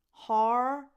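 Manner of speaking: loud "har" as in heart; "har", pushed out loud, rhyming with car; vowel like heart. Shown as a demonstration. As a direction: say "har" with an open ah and R. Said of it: "like heart".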